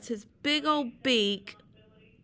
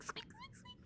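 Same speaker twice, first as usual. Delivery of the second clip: very high, soft mouse squeaks; tiny squeak; 20 dB quieter than usual. Shown as a demonstration. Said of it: "sounds like a little squeak".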